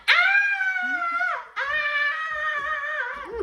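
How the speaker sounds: put-on high squeaky drunk wail; mock a drunk friend's shrill cry; drunk pitchy voice. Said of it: "Kayla voice get real pitchy when she drunk".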